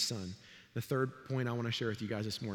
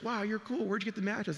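put-on impressed kid friends; 7 semitones higher than usual. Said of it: "my friends are like".

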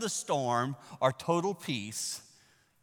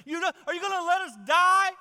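high, loud accusing shriek; screaming at him; 10 dB louder than usual. Retold or imitated. Disciples screaming at Jesus, high and loud. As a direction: mock-scream the complaint at Jesus. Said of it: "screaming at him".

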